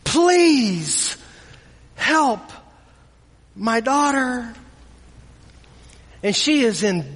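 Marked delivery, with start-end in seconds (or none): 0.0-4.5 slow, higher pleading with a break between words; begging plea. Acted. Mother begging Jesus for her daughter, slow plea. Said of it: "begging him".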